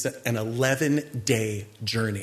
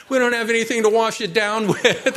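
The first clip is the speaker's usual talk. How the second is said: high, loud whine with a laugh; mock whining; 8 semitones higher, 6 dB louder than usual. Preacher mimics thirsty Israelites, loud laughing mock whine. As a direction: whine it over the top, laughing. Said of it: "they start complaining again".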